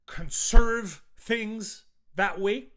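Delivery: conserve drawn out slow, loud and high; stress on conserve. Shown as a demonstration. Ranting to viewers, hammering the word conserve. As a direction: lean hard on conserve to land the pun. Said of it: "It's in the name".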